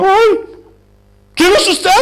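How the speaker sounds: high-pitched, hammed-up alarm; startled mock outcry. Man waking beside a stranger after drinking, mock-startled. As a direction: act out waking in shock, pitch jumping up.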